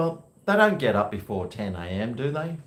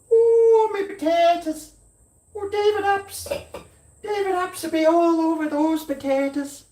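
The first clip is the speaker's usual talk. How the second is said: shrill high panic, much higher; high panicked shriek; 5 dB louder than usual. Acted. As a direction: shriek as the pot boils over. Panics about burning potatoes.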